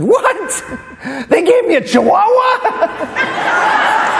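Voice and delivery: very high shouted squawk; feigned shocked disbelief. Acted. Fake blind man learns his dog is Chihuahua, shrieked disbelief. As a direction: cry out in mock outrage, stretching it out.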